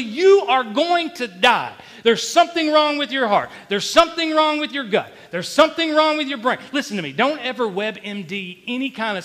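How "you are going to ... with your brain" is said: loud, high, hammering repetition; full-force re-enactment of inner dread; relentless accusing thoughts. Retold or imitated. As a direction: pound out each warning like an accuser.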